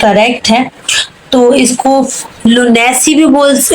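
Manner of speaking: ordinary talk, nothing marked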